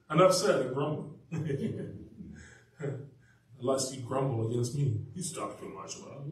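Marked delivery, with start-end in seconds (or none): 1.2-2.3 soft laugh. Real, not acted. Laughing after joke. 5.1-6.3 whispered aside. Real, not acted. Self-joke aside.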